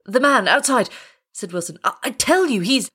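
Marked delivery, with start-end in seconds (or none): 0.1-0.9 high, urgent explanation; insist, alarmed at not being believed; agitated insistence. A narrator's reading. Agitated Wilson insists to doubting pilot. 1.8-2.9 high, stumbling protest; agitated stammer. A narrator's reading. Agitated Wilson insists, is cut off.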